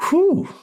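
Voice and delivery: high, loud exhale of relief; blowing off the tension of the chapter; relieved whew. Real, not acted. Reader finishing the emotional chapter, loud relieved whew.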